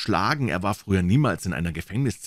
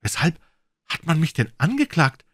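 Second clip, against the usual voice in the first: higher, urgent frightened plea; higher alarmed question; anxious question; 10 semitones higher, 4 dB louder than usual. A narrator's reading. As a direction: beg to know why, in fear. Panicked prisoner questions guard.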